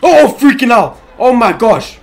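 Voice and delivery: loud, high frightened yell; startled scream. Real, not acted. Gamer hit by a jump scare, screaming in fright.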